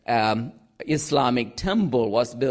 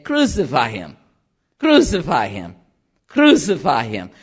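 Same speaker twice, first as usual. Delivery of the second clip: lightly imitated mob chant, louder; crowd demand; 4 dB louder than usual. Crowd turning on him, demanding chant. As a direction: hammer each cry, falling at the end.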